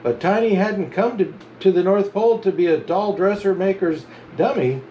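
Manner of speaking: ordinary delivery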